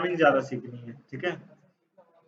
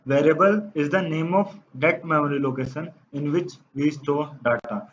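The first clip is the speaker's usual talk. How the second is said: dictating slowly; much slower than usual. Shown as a demonstration. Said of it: "teacher dictates definition slowly".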